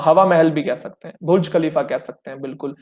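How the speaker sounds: normal talking voice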